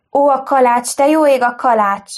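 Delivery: startled domestic panic; sudden alarm. A narrator's reading. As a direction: cry out as if something is burning. Mother suddenly remembers the cake.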